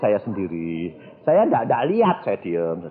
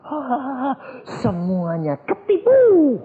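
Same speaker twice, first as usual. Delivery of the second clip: gloating demon laugh; 13 semitones higher than usual. Retold or imitated. Devil mocking everyone he fooled, hammed-up gloating laugh. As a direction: act out a devil's triumphant laugh. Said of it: "dia ketawa besar sekali".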